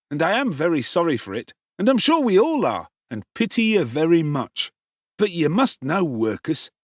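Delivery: high, sneering fake pity; jeering affected pity. A narrator's reading. Noah mocks Oliver with false pity, jeering. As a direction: pretend to be sorry while mocking. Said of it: "speaking in a jeering tone of affected pity".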